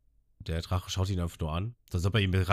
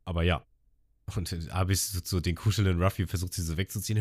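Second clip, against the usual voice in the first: ordinary talk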